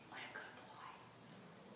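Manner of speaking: whispered praise to a horse; slow hushed whisper of praise. Retold or imitated. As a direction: breathe it out slowly, no voice.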